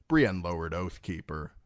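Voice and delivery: usual speaking voice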